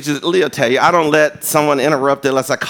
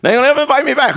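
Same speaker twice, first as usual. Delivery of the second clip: exclaimed aloud; 8 semitones higher, much faster than usual. Retold or imitated. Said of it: "And I thought".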